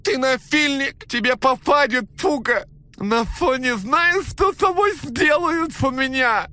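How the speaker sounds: high, loud, nasal-blocked threats; broken-nose voice. Acted. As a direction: yell through a broken nose.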